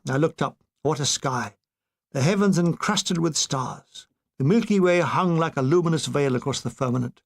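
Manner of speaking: plain reading voice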